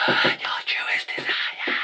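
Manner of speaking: gasp, then an eerie slow whisper; dramatic whisper. Retold or imitated. The narrator echoing the villain's line in a spooky whisper. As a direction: breathe it like a spooky secret.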